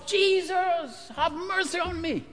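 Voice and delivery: high, pleading yell. Acted. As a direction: beggar yells over the crowd. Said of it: "he yells".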